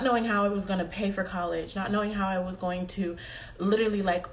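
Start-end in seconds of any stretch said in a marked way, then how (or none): none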